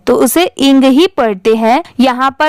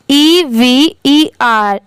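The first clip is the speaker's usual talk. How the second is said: slow spelling out; much slower than usual. Shown as a demonstration. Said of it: "इसे क्या पढ़ेंगे".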